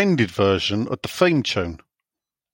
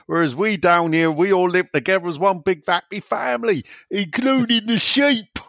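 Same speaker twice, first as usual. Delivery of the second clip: mock West Country farmer voice, rising higher; West Country accent; 5 semitones higher than usual. Reading a listener's West Country message.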